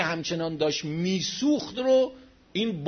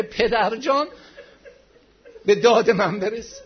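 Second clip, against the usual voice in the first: raised, loud plea for help; anguished wail; 5 semitones higher, 5 dB louder than usual. A narrator's reading. Fatimah behind the door begs her father for help, wailing. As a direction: wail it out as a desperate cry.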